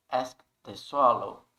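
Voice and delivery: "Asked" in one syllable, then "the swallow"; T-ending asked. Shown as a demonstration. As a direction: say "Asked" as "askt" before "the swallow". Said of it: "mas um som de T". ("Asked" numa sílaba só, com som de T)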